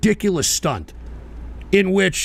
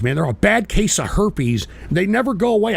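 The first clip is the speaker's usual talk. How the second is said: ordinary talk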